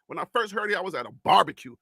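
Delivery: ordinary talk, nothing marked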